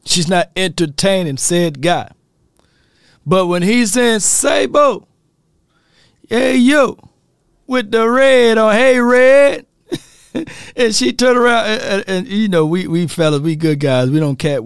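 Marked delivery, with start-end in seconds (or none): 4.2-8.6 high, playful street catcall; hollered-out flirty catcall; mock catcall. Imitating men's street catcalls. 8.7-9.7 high, louder call to a passerby; playful raised-pitch catcall; mock catcall. Imitating men's street catcalls.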